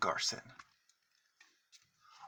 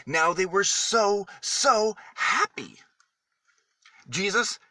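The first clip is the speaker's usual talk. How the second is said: joyful; 8 semitones higher, 5 dB louder than usual. A narrator's reading. Storyteller to children, describing the friends' joy delightedly. Friends see Jesus alive.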